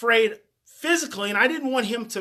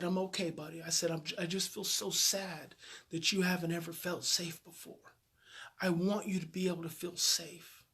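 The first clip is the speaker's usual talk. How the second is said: much softer, low, tender reassurance; gentle comfort; 4 semitones lower, 11 dB quieter than usual. Retold or imitated. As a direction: hush it down like soothing a scared kid. Comforting the boy after crying.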